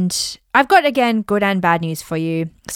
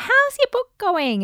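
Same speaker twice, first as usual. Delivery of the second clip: high, slow sing-song question; doting relative. Retold or imitated. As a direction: coo it like a proud grandma. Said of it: "relatives can be like".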